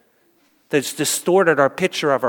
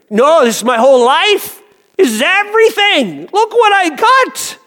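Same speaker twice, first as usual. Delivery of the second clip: high, loud, gushing boast; excited rich man; 12 semitones higher, 5 dB louder than usual. Acted. Rich man at heaven's gate shows Peter his gold, loud and high. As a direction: proudly show off your treasure.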